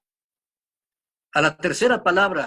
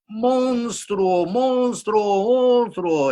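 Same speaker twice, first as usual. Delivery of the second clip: higher shouted word repeated like a cave echo; 7 semitones higher, 4 dB louder, much slower than usual. Retold or imitated. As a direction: throw it back three times like an echo. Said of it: "recibió la respuesta".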